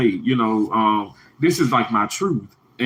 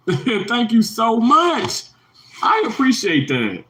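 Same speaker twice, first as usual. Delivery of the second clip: high, very drawn-out thank-you; real gushing thanks; 9 semitones higher, slower than usual. Real, not acted. Thanking a viewer for the super chat, gushing. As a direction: let the thanks soar and stretch slowly.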